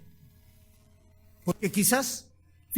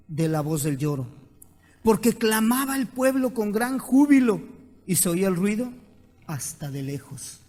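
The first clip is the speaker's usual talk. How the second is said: scripture reading; 4 dB louder than usual. Acted. The pastor reads the verse to the congregation, solemnly. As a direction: proclaim the verse.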